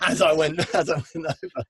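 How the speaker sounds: ordinary talk, nothing marked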